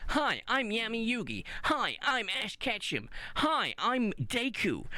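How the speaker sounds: high, slow shonen hero voice; anime boy voice. Retold or imitated. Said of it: "that really annoying same voice".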